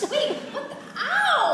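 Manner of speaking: sudden high pained yelp, laughing; startled yelp. Acted. Betty yelping at Kyle's bite, high and startled. Bitten during a kiss.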